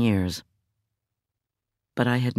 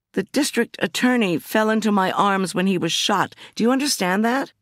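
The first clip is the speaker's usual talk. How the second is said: much higher, urgent and upset; exasperated insistence; 10 semitones higher than usual. Acted. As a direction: make him understand. Insisting to the doubting cop.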